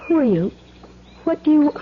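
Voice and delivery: usual speaking voice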